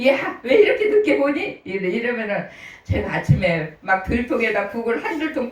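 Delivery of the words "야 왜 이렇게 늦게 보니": high, loud scolding elder; impatient old folks nagging the late girl. Retold or imitated. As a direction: call out like a grumpy grandparent. Elders scolding her for coming late.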